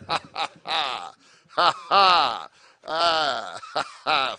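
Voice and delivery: mock self-satisfied laugh at his own joke; own laughter. Real, not acted. Comic laughing at his own joke, self-pleased laugh. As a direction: chuckle at yourself.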